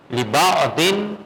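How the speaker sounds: slow drawn-out demo of لبا دن; teacher slowly models لبا دن for the class; demo reading. Shown as a demonstration. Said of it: "ایک طریقہ یہ ہے لبا دن".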